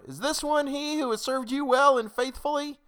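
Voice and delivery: very high, loud crowd's question; high-pitched outcry; crying out. A narrator's reading. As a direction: cry out in protest, pitched right up. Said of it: "And we cried out".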